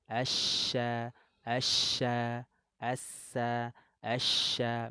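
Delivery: asya with spread airy sh, asa with sharp s; shin versus sin. Shown as a demonstration. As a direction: contrast the sh and s. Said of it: "kalau shin menyebar angin".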